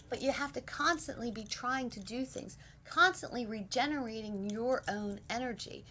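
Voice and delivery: ordinary talk, nothing marked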